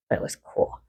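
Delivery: a hushed, breathy aside; whisper. Real, not acted. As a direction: whisper it like a private thrill. Secret thrill at bedtime.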